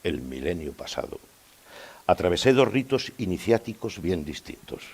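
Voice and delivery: pausado, con largas pausas y cadencias descendentes; monólogo teatral recitado. Acted. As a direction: recita como un poema confesional, creciendo en los golpes y cayendo al final. (recitado teatral solemne)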